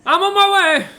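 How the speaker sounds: loud, very high holler; calling out from above. Acted. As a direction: bellow it down the ladder. Aussie calls down before climbing.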